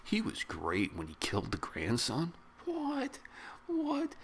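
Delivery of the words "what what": very high disbelieving what; stunned twice-repeated what; shocked. Real, not acted. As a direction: squeak out the disbelief. Shocked at grandson's killing.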